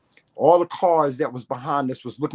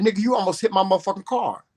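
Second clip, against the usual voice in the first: higher, fast angry retort; retold angry yell; 6 semitones higher, faster than usual. Yelling at the driver who nearly hit her car, fast and angry. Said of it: "I said".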